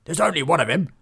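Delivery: rally the men like a sergeant; ordering. A narrator's reading. Sergeant rallies bailiffs against the lone stranger, loud order. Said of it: "ordered the sergeant".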